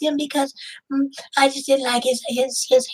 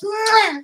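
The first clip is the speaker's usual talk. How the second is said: kiss sound; 5 semitones higher than usual. Pretend. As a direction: blow a big kiss.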